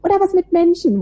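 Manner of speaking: high-pitched mock pupil voice; imitating school leavers. Podcaster mimicking pupils asked about career plans, high mock voice. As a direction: play a naive school leaver, voice lifted high. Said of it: "wenn man so ein bisschen mit Schülern spricht".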